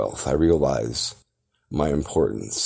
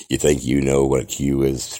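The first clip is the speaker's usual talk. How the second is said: ordinary delivery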